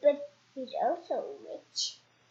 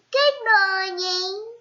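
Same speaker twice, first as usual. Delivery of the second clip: high, slow goblin hello; 5 semitones higher, 6 dB louder, much slower than usual. Acted. Goblin greets Mister Uppity again, high and slow.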